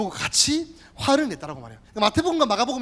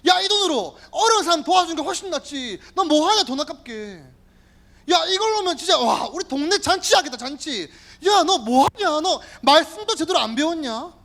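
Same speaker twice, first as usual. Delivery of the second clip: higher, scolding angry rant; angry Judas; 6 semitones higher, 3 dB louder than usual. Acted. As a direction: scold her for wasting the money. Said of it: "막 화를 내는 거예요".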